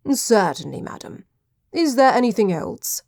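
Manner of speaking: lightly voiced old butler; elderly butler. A narrator's reading. Butler to his mistress after her request, elderly butler. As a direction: answer as an obliging butler.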